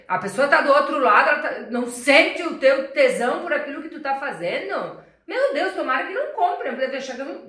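loud, high, laughing; laughing mockery. Real, not acted. Salesperson laughing while mocking a dull seller. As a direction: laugh as you mock the flat seller.

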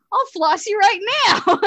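high, loud quoted threat; loud mock threat. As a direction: belt the threat out. Quoting episode line, high loud.

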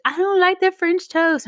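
put-on high, whiny kid complaint; little brother voice. Sister imitating her little brother's high complaint. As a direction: pitch up like a picky little boy. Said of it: "and he's like".

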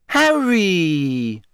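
high, drawn-out gushing greeting; beaming. A narrator's reading. Rita greeting Harry in the pub, beaming. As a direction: coo the name with showy delight. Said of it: "she said, beaming".

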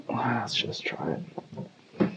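hushed, slow whisper. Real, not acted. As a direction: whisper it before tasting. About to taste beer.

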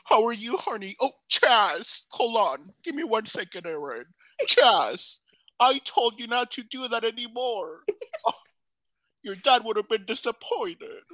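a hint of [name] scolding Chaz; playful [name] voice; [name] impression. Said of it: "[name], is that you?".

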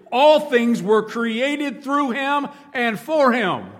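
preaching at full force. Acted. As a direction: proclaim the verse to the rafters. Preaching and reading scripture aloud.

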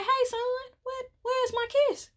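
high, sassy mock demand; playful demand. To daddy visiting her at work, high sassy demand. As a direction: ask for the kiss like a spoiled daughter.

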